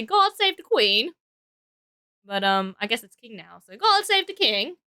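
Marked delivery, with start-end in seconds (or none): 0.0-1.1 mock British. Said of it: "Trying to mimic it". 3.8-4.8 high, slowed mock British accent; a playful stab at a British accent; Queen's English, hammed up. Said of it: "Trying to mimic it".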